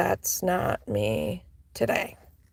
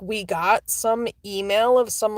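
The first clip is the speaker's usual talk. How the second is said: ordinary talk, nothing marked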